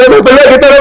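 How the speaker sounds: very high, rushed mock threat; aggressive confrontation. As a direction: re-enact getting in his face. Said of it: "I did get aggressive".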